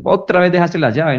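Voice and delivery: mock the same old slip; sarcastic jab at a partner leaving in a hurry. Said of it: "cuando está en un momento de salida".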